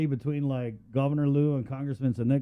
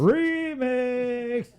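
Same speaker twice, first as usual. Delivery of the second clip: playful announcer call; 11 semitones higher than usual. Pretend. Joking call out.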